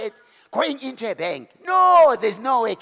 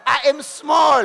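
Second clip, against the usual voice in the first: sign speaking; 5 semitones higher, 7 dB louder, slower than usual. Pretend. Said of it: "It says itself".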